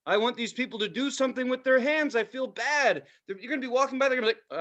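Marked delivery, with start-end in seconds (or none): none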